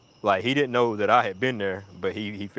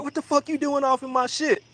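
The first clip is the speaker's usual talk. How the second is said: high-pitched indignant demand; hammed-up squawky confrontation; mock outrage. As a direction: imitate an outraged friend, pitched way up. Friend confronts the intruder.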